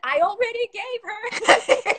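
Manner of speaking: high, slow, laughing reply; laughing through the words; laughing retort. Retold or imitated. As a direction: laugh it out in disbelief. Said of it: "I said".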